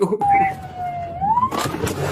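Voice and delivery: big burst of laughter; loud laugh. Real, not acted. Streamer laughs at call sign.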